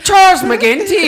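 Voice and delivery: put-on Irish accent. Inventing a name for his Irish character, Irish accent. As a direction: announce yourself as a comic Irishman. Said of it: "good friend from Ireland".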